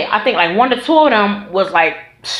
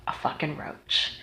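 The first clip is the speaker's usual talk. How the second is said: hushed disgusted reveal; 8 semitones lower, 18 dB quieter than usual. Retold or imitated. Reveals roach in food.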